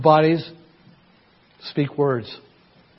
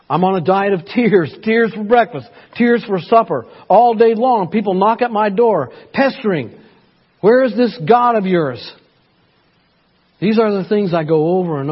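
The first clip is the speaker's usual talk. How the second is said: higher and louder, lamenting psalm; impassioned scripture reading; 6 semitones higher, 5 dB louder than usual. Acted. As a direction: cry the lament out to the congregation.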